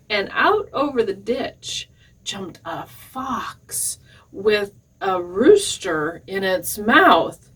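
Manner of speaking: ordinary delivery, nothing marked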